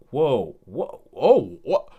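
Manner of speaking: swooping exclamations of disbelief; amazed. Retold or imitated. Viewer watching the comics come to life, amazed. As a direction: re-enact being blown away, each word separate. Acts out amazed viewing reaction.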